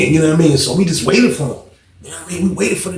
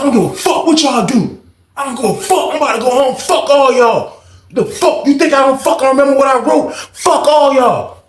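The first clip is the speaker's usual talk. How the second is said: high, heated cursing; defiant yelling; 9 semitones higher than usual. Retold or imitated. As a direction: cellmate cursing them all out, high and heated.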